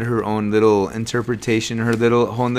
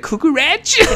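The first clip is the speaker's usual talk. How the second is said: much higher, sing-song "Cucaracha"; mock imitation. Teasing sister's word, high mock "Cucaracha". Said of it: "she was like".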